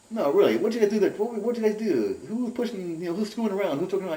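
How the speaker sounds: very high, insistent imitation; retold agitated questioning. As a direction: mimic a friend pressing frantically for answers. Retold friend insisting on an answer.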